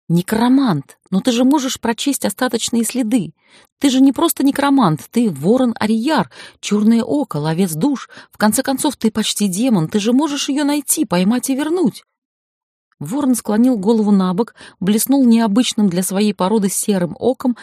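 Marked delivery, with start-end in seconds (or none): 0.1-12.0 pleading. A narrator's reading. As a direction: press him, insistent and eager. Tayra pleading with Raven.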